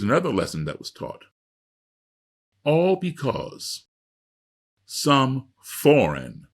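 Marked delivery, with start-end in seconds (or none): 4.9-6.5 "foreign" drawn out slowly and pressed hard; emphasize "foreign" like underlining it; stress on foreign. Shown as a demonstration. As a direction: lean on "foreign", slow and heavy. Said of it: "underscore, circle, highlight the word foreign".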